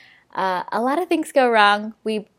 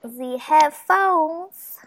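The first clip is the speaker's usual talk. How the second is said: drawn-out; 6 semitones higher, much slower than usual. Shown as a demonstration.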